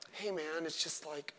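very quiet, lisping parody; mock lisping softness. Soft preacher chatting to his church, mock lisping. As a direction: lisp it softly, mocking gentle male preachers. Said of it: "stop lisping".

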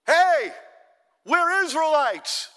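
high, loud, drawn-out holler; calling out to the enemy at full voice; acted yell. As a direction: shout it slowly across a valley. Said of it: "and he yells out".

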